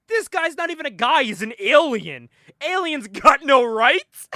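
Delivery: high, loud mock outrage; indignant protest. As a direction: argue back like an outraged boss. Boss argues back at threat.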